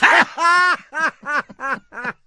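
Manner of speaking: loud, high burst of laughter; big real laugh. Real, not acted. Co-host reacts to the Preparation H punchline, helpless laughter.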